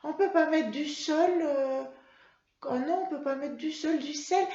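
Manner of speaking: put-on high, childlike puppet voice sounding out words; Mimi puppet voice. Teacher voicing Mimi the puppet for pupils, high childlike voice.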